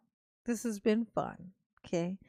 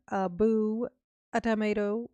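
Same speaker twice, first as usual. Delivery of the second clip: ordinary talk, nothing marked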